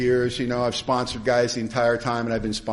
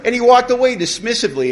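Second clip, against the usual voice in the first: ordinary talk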